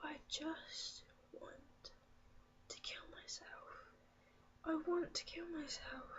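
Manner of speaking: hushed, breaking off into near-whisper; real quiet despair. Real, not acted. Recalling a despairing thought while distressed.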